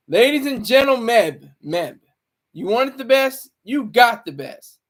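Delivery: announcer intro. Acted. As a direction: hype up the guest like a ring announcer. Mock announcer introducing a guest.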